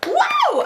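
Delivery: exaggerated high-pitched shrieking laugh, ending in a whoop; screaming laugh. Re-enacting laughing at the friend's failed calls, hammed-up screaming laugh. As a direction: cackle up high like you're dying laughing. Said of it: "I'm screaming laughing".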